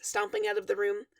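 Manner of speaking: ordinary delivery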